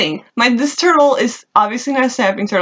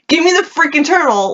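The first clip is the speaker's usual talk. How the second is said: higher-pitched retold scolding; mock exasperated demand; 6 semitones higher than usual. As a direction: act out fed-up ordering of kids. Retold scolding of boys stealing turtle.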